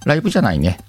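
ordinary talk, nothing marked

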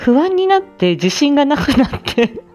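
ordinary talk

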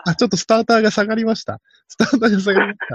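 high-pitched mock race commentary; stalling race announcer. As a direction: report each small development to fill time. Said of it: "なんとか場をつなごうとする実況中継の人".

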